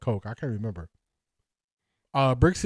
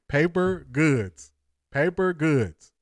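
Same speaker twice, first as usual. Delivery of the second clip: slow; much slower than usual. Shown as a demonstration. Savoring a chat comment, drawn out.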